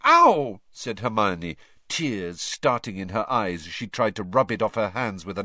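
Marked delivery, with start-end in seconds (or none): 0.0-0.6 high, loud yelp of pain; sharp cry of sudden pain. A narrator's reading. Boils erupt on hands.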